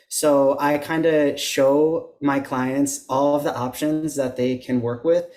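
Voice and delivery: usual speaking voice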